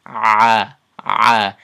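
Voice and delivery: loud open Ah from the middle of the throat; loud rising throat vowel; throat A demo. Shown as a demonstration. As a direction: project the Ah upward from the throat. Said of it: "so it sounds like this".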